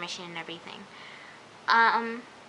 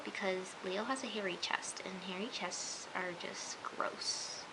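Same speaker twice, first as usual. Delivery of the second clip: hushed, grossed-out whisper; whispered aside; 3 semitones lower, 12 dB quieter than usual. Real, not acted. Vlogger confiding disgust at a hairy chest, whispered. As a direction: whisper it like a secret confession.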